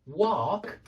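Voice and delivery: "wok" with a long open ah, like "wahk"; American vowel. Shown as a demonstration. Teacher repeats how Americans say it, "wok" as long wahk. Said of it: "They say wok exactly the same way".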